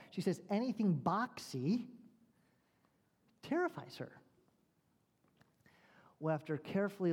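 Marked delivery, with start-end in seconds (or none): none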